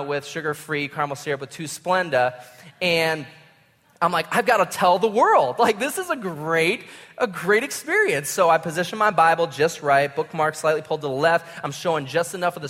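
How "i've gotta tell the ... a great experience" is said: lightly hammed-up, higher-pitched enthusiasm; mock gushing excitement. Preacher mocking his own urge to post from Starbucks, gushing. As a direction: overplay being thrilled about a trivial moment.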